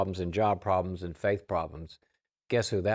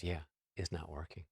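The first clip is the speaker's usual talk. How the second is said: soft, downcast agreement; hushed, resigned acknowledgement; subdued admission; 3 semitones lower, 12 dB quieter than usual. Retold or imitated. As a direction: concede it very quietly. Mom admits advice fails.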